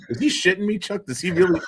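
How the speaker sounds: high, amused disbelief; mock-stunned coach asking his buddy; Thompson incredulous. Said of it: "Thompson looks at me and goes".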